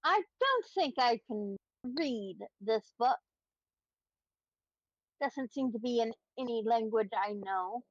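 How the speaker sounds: ordinary talk, nothing marked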